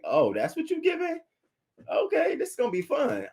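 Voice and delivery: high-pitched mock glee; high mock surprise. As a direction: squeal it like a fake-excited fan. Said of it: "I'm like".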